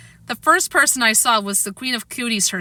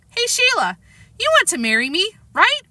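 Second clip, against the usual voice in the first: cheerful; 10 semitones higher than usual. Acted. Fudge proposes cheerfully.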